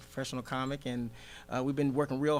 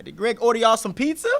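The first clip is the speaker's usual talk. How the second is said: raised, louder mock-dad question; imitating dad; 8 semitones higher, 10 dB louder than usual. Comic retells dad quizzing his friends, loud mock accusation. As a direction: play the suspicious father, pitched up high. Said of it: "my dad always painted me as the bad guy".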